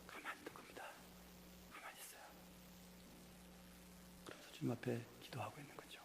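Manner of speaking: whispered, barely audible; hush, as if waiting before God; hushed stillness. Acted. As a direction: drop to a near-silent whisper. Acts out David falling silent.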